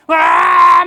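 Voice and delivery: loud, high shouted cheer; crowd roar. Retold or imitated. Crowd clamor at heaven's gate. (크게 외치는 함성)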